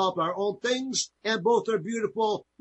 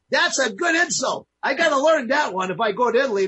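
delighted; 6 semitones higher, 4 dB louder than usual. Real, not acted. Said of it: "laughing host delighted".

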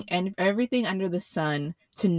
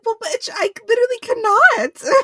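much higher, much louder exasperated yell; retold shout; 8 dB louder than usual. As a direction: shriek it at her, fed up. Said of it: "I was just like".

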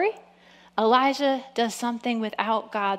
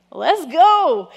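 loud, raised-pitch gung-ho cry; eager shout; 4 semitones higher, 8 dB louder than usual. Acted. Preacher playing Elijah answering God's command, eager shout. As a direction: shout it like you're raring to go.

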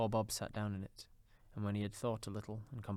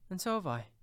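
put-on high, louder child chiming in; exclaiming child; 9 semitones higher, 5 dB louder than usual. A narrator's reading. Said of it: "exclaimed another".

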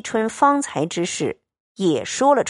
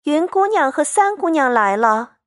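high-pitched servant announcing arrivals; servant's announcement; 8 semitones higher than usual. A narrator's reading. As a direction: call it out high, like announcing guests at the door. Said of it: "果然报".

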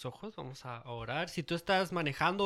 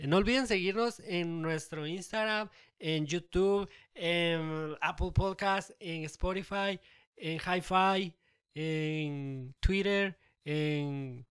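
drawn-out list; 2 semitones higher, much slower than usual. Pretend. Listing joke platforms slowly.

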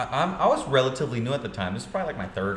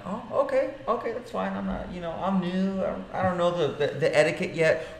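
new agent placating the rude realtor, high and meek; meek and apologetic; 8 semitones higher than usual. Retold or imitated. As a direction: shrink back and placate him. Retold meek reply to rude realtor.

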